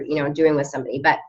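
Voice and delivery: ordinary talk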